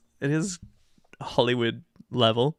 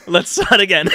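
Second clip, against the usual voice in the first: laughing; 7 semitones higher, 10 dB louder than usual. Real, not acted. Laughing restart.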